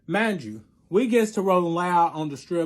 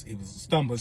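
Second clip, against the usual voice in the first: usual speaking voice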